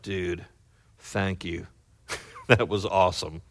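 low, soft retold thanks; quiet gratitude. Man thanks his accuser years later, quiet gratitude. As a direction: drop right down, imitating a grateful friend.

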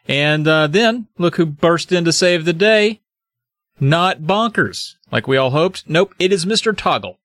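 plain reading voice

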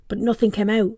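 usual speaking voice

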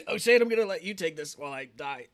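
choking on a drink. Real, not acted. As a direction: talk through leftover choking coughs. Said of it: "I choked on my water".

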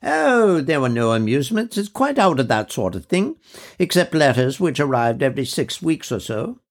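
a lady of mature years, fondly remembering; high, fluting voice of an officer's widow; elderly widow. Acted. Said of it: "an officer's widow".

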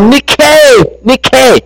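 high, jubilant victory cry; joyful finish-line yell, pitched high; triumphant yell. Retold or imitated. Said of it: "he threw his arms up into the air and he yelled".

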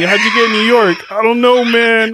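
high, loud comic exchange; mock bewilderment.

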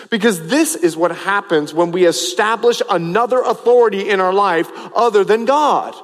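normal talking voice

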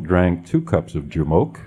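ordinary talk, nothing marked

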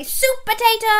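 high, ringing hero cry; superhero call. Pretend. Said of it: "put your superhero arm out and say".

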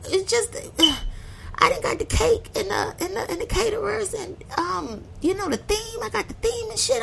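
high, soft, near-tearful whine; whiny hurt protest. Retold or imitated. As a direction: plead it high like your feelings are hurt. Retelling her hurt reply.

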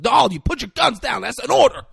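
loud, high-pitched commanding shout; acted firm order. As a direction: order armed men to stand down. Acted order to lower guns.

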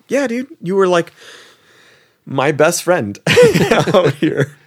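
high, bright-eyed and eager, with a laugh; excited. Retold or imitated. Telling the new buddy he's a best friend, eager and high.

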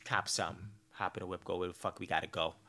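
soft, low-key mumble to a partner; casual quiet suggestion. Retold or imitated. Inviting partner to Starbucks, soft offhand mumble. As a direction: toss it off softly, no effort. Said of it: "I'm like".

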